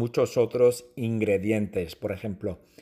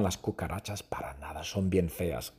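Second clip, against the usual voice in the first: disgusted whisper; 6 semitones lower, 9 dB quieter than usual. Real, not acted. Disgust at cockroaches.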